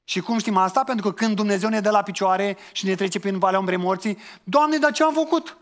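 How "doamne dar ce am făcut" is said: high, loud cry of protest at God; indignant protest. Retold or imitated. Sufferer crying out to God, high and loud. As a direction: wail it up high, outraged.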